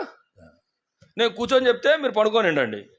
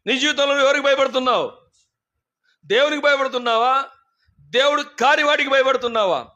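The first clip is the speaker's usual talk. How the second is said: ordinary talk, nothing marked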